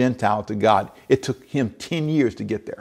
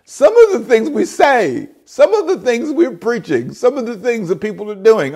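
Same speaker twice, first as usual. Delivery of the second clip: laughing; 9 semitones higher, 6 dB louder than usual. Real, not acted. Laughing, breathless preacher.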